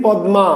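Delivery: "পদ্মা" as pad-ma; both d and m sounded in "পদ্মা"; written form. Shown as a demonstration. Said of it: "লিখেছি".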